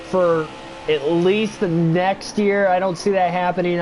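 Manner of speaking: ordinary delivery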